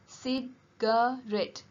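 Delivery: "Cigarette" said slowly, each syllable spelled out; a slow, careful "Cigarette" for pupils to write down; slow dictation. Shown as a demonstration. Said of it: "It has three sounds and three syllables".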